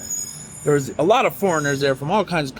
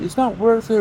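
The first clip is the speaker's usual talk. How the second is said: high-pitched woman's admonishment; imitated Russian woman; 7 semitones higher than usual. Russian woman trying to calm him down, imitated in a high voice. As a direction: imitate her talking him down, pitched well up. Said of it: "the Russian woman was like".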